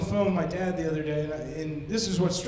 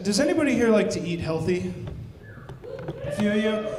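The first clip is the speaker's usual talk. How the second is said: ordinary talk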